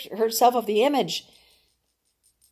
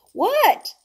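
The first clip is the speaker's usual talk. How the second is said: a high, sharp yelled what. Acted. Rena yelling to family uphill. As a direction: shout it out high over the noise. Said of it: "she yelled out".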